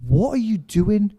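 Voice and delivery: slow, loud, high-pitched disbelief; astonished question. Retold or imitated. Stage manager finds roadie with a kettle, acted astonishment. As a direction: draw it out in retold bewilderment.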